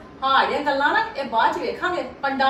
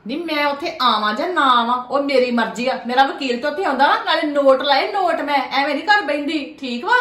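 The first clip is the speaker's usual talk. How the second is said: defiant retort; 5 dB louder than usual. Acted. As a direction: spell out each word in defiance. Mother-in-law defiant in argument.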